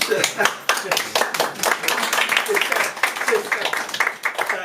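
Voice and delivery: long breathy laugh; laughter. Real, not acted. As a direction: burst into a long, real laugh. Laughs while losing her words. (long éclat de rire)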